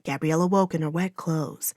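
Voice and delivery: plain reading voice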